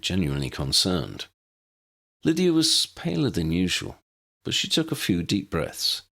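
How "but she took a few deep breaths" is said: ordinary delivery, nothing marked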